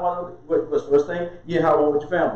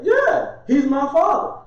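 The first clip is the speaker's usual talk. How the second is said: much higher, bold affirmation; exultant claim, pitched way up; defiant high yes; 10 semitones higher, 3 dB louder, slower than usual. Acted. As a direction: proudly insist on it. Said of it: "defiant answer to critics".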